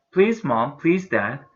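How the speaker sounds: eager pleading to his parents; pleading cry. A narrator's reading. Toby begging his parents, eager pleading cry. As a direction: beg for the name. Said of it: "cried Toby".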